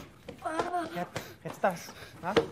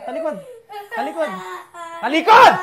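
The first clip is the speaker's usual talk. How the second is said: repeated order growing to a shout; stern father building to a yell; angry command; 4 semitones higher, 10 dB louder than usual. Acted. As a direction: bark it harder each time until you explode. Father orders child to turn around.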